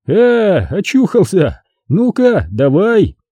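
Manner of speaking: high-pitched, lively encouragement; pleased exclamation. Acted. Friend reviving a drunk man, briskly encouraging. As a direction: egg him on as he comes round.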